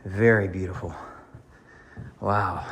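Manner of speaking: winded from climbing stairs; heavy breathing. Real, not acted. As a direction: breathe hard between words. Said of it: "I apologize for the heavy breathing".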